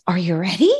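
bright, eager call to rev up a pup; upbeat dog-training voice. Shown as a demonstration. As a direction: get the dog excited to start. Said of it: "you can hear my voice tone is a little different".

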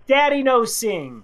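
lightly voiced toddler protest. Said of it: "He looks me in the eye and goes".

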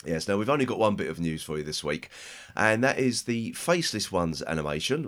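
normal talking voice

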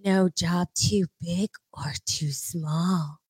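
slow whispered seductive read; sexy whisper. Pretend. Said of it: "Do sexy voice".

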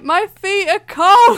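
slow, loud and high, laughing as she says it; pleading through laughter. Retold or imitated. Patient insists to the dentists her feet are cold, laughing wail. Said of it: "like that".

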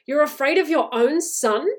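high, loud incredulous question; indignant cry. A narrator's reading. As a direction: cry out while struggling. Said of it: "she cried, struggling".

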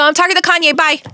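high-pitched, hurried retort, acted out; defiant brush-off. Host hanging up on her boss, defiant brush-off. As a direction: cut the boss off and hang up.

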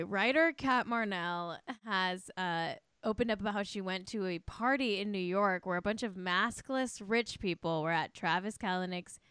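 ordinary talk

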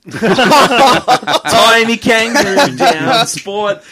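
slow, laughing sung line; laughing song parody. Acted. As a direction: sing it through laughter.

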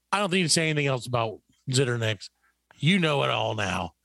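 ordinary delivery

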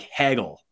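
Hagel with a short flat a, HAG-ul; short a pronunciation. Shown as a demonstration. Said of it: "that made me always wanna say".

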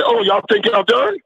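high, incredulous coach voice; imitating the coach. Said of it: "he looked at us and said".

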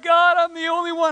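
high, pleading cry to God; a complaining prophet crying out to God, high and whiny; whiny Elijah. Retold or imitated. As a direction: whine it up high like a desperate prophet. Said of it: "literally crying out to God".

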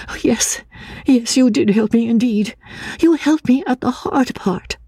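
put-on high, timid voice of a fearful girl; frightened young woman. A narrator's reading. Anne, fearing the asylum, asks the narrator in a high timid voice.

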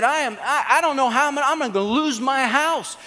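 high, stumbling imitation of panic; worked-up retelling, pitched high; acted freak-out. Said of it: "I am freaking out".

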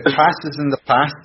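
high Scouse attempt with a laugh in it; Welsh-tinged bad Scouse. Podcaster trying a test phrase for a Liverpool guest, bad Scouse accent. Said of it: "little Welsh".

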